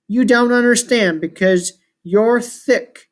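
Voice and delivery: ordinary delivery, nothing marked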